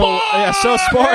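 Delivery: high, stretched-out cheer; mock rallying shout. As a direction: holler it like a crowd chant. Group shouts out the topic.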